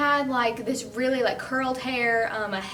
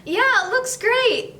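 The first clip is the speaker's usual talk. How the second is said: awkward reassurance; 11 semitones higher, 4 dB louder than usual. Retold or imitated. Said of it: "He was just like".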